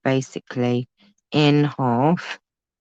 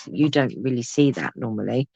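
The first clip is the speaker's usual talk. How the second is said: normal talking voice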